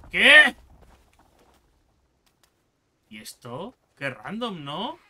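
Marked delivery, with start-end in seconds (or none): 0.1-0.6 sudden loud high "what?"; startled shout. Real, not acted. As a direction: jolt out a startled cry. Sudden surprise in game.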